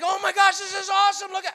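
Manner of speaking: high, loud, breathless wonder; a loud, giddy gasp of amazement; awestruck Peter. Acted. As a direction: gush like an amazed fisherman. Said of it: "he's like".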